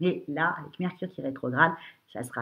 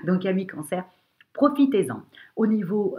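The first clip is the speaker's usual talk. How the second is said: excited; 5 semitones higher, 5 dB louder than usual. Real, not acted. Enthusiastic push after joke.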